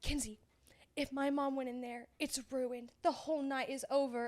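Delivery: low, soft, grave warning; hushed dramatic bride. Retold or imitated. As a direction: say it like the world is ending, quietly. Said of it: "she's like".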